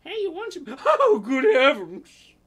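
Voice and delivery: high-pitched British lady gasping, slowed right down on good heavens; posh British woman. Pretend. Girlfriend discovering the naked flatmate, gasping in a posh British accent. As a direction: play the shocked posh English girlfriend, laughing through it. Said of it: "you have to do a British accent".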